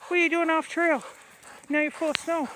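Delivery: high coaxing dog-talk voice. Real, not acted. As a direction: sweet-talk the dogs along. Talking to the dogs.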